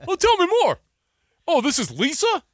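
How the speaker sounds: string the scammer along with fake enthusiasm; mock eager scam-call victim. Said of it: "they'll call and they go".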